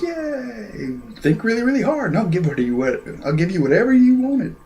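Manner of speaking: high-pitched cheerful cartoon robot; squeaky put-on cheer; mock cheery gadget. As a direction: chirp it like an eager little wish-granting pot. Voices the rice pot robot.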